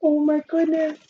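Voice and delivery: put-on expressive read-aloud for young kids; storybook reading. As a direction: read it like story time for the class. Teacher reads picture book aloud.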